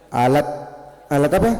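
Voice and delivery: normal talking voice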